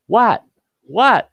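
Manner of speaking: high, loud, questioning call; acting out someone oblivious, calling back loudly; clueless "what". Tall bowler looks around amid the panic, loudly baffled. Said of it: "looking around going".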